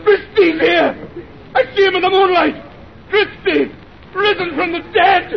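very high, horrified cry; hysterical terror. Acted. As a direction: wail in horror as at a risen corpse. Sees supposed dead man.

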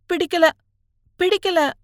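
high, small pitiful voice; forlorn. A narrator's reading. Student confessing he dislikes college, forlorn. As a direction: repeat it helplessly, close to tears.

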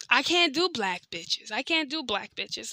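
slow, put-on uncle voice; slow uncle impression. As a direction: drawl it out as the uncle. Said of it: "he'll be like".

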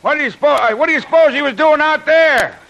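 loud, high-pitched amazement; astonished. Acted. Sailor learning Jim Bradley was in the sea, astonished. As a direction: exclaim and stumble over the question in amazement.

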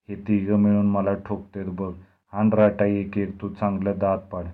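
plain reading voice

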